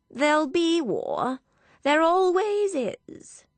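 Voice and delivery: high, drawn-out dowager voice; matter-of-fact old aunt. Acted. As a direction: pronounce it as settled fact, high and slow. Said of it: "matter-of-factly".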